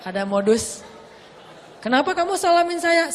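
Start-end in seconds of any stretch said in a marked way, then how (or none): 1.8-3.1 suspicious wife. Retold or imitated. Suspicious wife questioning husband.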